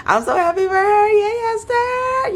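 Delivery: sing-song it like a proud fan; excited cheer. Real, not acted. Vlogger cheering for Esther from afar, high and delighted.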